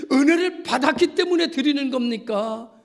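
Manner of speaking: high, loud challenge to the listeners; forceful rhetorical question. Real, not acted. Preacher asking congregation why they worship, forceful rhetorical question. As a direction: ring out the first option strongly. Rhetorical contrast of two motives.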